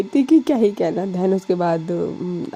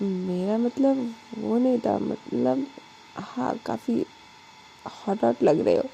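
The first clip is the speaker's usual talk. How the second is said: softer, slow, halting compliment; soft hesitant flirt; 3 semitones higher, 6 dB quieter than usual. A narrator's reading. Alisha hesitantly calling Anu hot, softly. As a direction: let the words drift close and shy.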